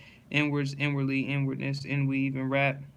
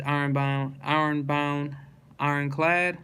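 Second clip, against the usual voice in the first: usual speaking voice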